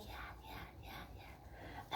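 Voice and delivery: whispered yeahs; whispered child. Retold or imitated. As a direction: whisper it like a shy little girl. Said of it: "She's like".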